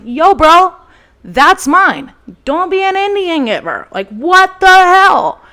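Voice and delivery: loud and high, the last words slow; angry yell. Retold or imitated. To her ex carrying off her Keurig, a loud high yell. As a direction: call him out across the room. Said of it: "And I was like".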